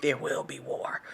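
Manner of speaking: low, soft, ominous there will be war; hushed prophetic voice. Shown as a demonstration. Minister replaying her own prophecy, low and hushed. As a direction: breathe it like a prophecy. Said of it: "That's how I said it".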